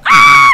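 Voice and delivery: piercing high, loud scream; scared scream. Real, not acted. Player caught by the monster, terrified scream. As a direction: scream at full force in terror. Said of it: "I scream like a fucking pussy".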